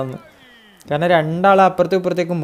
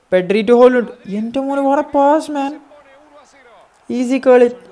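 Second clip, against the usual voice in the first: high, loud and drawn out, amazed at the pass; thrilled shout; 8 semitones higher, 6 dB louder, slower than usual. Real, not acted. Gamer marvelling at Pedri's pass to his viewers, excited shout. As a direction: gush over the pass, stretching it out. Assist and goal in game.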